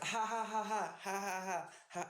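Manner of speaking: high-pitched posed laugh for a photo; fake laugh. Shown as a demonstration. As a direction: laugh falsely in high, even ha-ha-has. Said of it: "just fake laugh".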